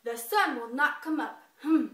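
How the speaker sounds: loud, defiant bear declaring; bear's voice. A narrator's reading. Bear insisting to the animals, loud and defiant. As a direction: boom it out as the stubborn bear.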